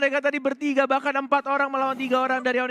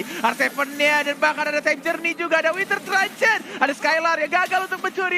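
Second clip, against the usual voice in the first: excited commentary; 6 semitones higher than usual. Real, not acted. Hype play-by-play during fight.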